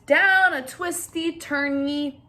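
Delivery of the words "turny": usual speaking voice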